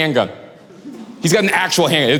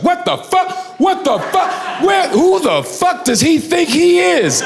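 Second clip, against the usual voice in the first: high, outraged shouting; mock furious yell; 9 semitones higher, slower than usual. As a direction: ham up a grown man losing it over a turtle. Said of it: "heard an adult man say that".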